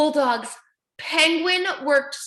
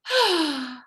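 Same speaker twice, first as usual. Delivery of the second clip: disappointed sigh; 2 semitones higher than usual. Acted. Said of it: "Penguin sighed".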